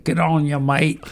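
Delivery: high, bright-pitched Aussie accent. Shown as a demonstration. Podcast host shows off his Aussie impression to listeners. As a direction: toss off an Aussie greeting in a put-on Aussie voice. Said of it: "or I can do Aussie".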